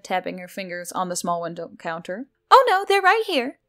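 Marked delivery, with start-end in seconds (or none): 2.5-3.6 high, bright chirp, much higher and louder; chirpy. A narrator's reading. Centaur customer answering the barista, high chirp. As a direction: chirp it like a cheerful bird. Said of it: "the centaur chirped".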